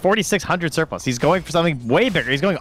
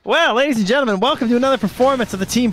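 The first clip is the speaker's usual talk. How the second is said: higher-pitched mock show announcer; 7 semitones higher than usual. Caster introduces a team's old routine, mock announcer. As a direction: present the team like a stage act.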